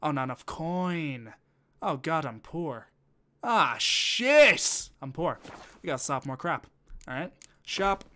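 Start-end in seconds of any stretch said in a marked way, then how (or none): 3.4-4.9 loud, high exasperated curse; frustrated outburst. Real, not acted. Streamer finding himself poor in the game, frustrated yell. Finds he has no coin.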